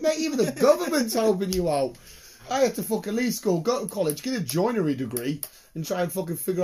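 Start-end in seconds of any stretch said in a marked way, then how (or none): 0.0-2.0 exasperated. Retold or imitated. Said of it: "I was like".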